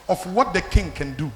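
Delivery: ordinary talk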